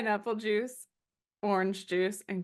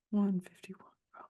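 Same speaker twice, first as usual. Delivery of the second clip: whisper; 6 dB quieter than usual. Acted. Whispered song line.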